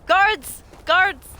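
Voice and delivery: high, loud shouts for the guards; urgent role-played yell; calling for help.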